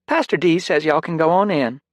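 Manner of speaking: smiling receptionist. Acted. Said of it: "the receptionist said with a smile".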